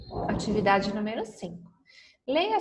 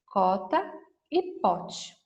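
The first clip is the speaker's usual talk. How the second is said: slow and clear; much slower than usual. Shown as a demonstration. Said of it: "Nós temos a palavra capa".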